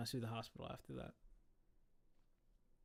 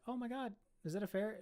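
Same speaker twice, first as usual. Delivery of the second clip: put-on higher, gushing old lady discovering a pet; delighted surprise; 7 semitones higher than usual. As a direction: gasp over the cute animal. Older pharmacy lady sees the ferret.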